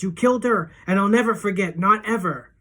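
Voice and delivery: loud, high furious shout; screaming. A narrator's reading. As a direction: scream that you will never forget. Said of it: "keep screaming".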